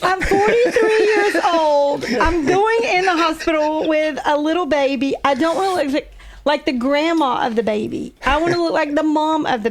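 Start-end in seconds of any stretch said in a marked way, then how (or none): 0.0-7.5 laughing. Real, not acted. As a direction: laugh while defending the dress choice. Wife laughing while explaining herself.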